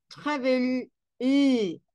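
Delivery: travel with final ul separated; ending sound of travel. Shown as a demonstration. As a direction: isolate ul after travel. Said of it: "¿Escuchan al final?".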